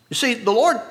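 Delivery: normal talking voice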